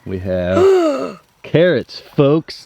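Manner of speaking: high, slow, gasping delight; excited. Real, not acted. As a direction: draw it out, overjoyed. Showing the harvest.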